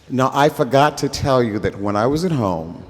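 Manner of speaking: usual speaking voice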